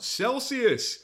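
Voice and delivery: high mock shout from the crowd; comic recalls a heckler shouting, high mock yell. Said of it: "this guy shouts out".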